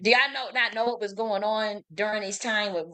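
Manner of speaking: usual speaking voice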